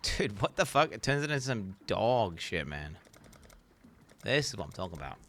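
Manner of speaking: ordinary delivery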